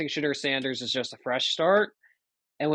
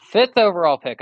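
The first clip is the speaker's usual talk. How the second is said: loud formal announcement; draft announcer; 3 semitones higher, 7 dB louder than usual. Pretend. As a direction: boom out the pick.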